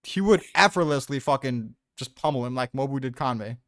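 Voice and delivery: usual speaking voice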